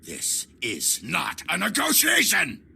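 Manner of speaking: high, outraged shout; angry shout. Acted. Villain refuses to negotiate.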